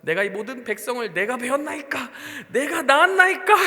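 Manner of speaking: rising, exploding protest to God; exasperated outburst. Retold or imitated. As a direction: shout it like a fed-up shepherd. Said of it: "이러".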